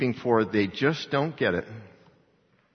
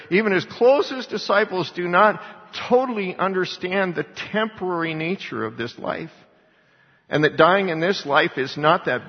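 louder, higher, pressing each phrase hard; impassioned preaching; 7 semitones higher, 4 dB louder than usual. Acted. Pastor driving home Jesus wept to his congregation, fervent preaching. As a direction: preach it up to full force, slowing on the key lines. Preacher builds to full force.

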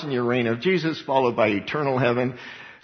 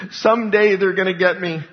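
louder, higher imitated dread; fearful cry; 6 semitones higher, 5 dB louder than usual. Preacher voicing persecuted believers, loud and fearful.

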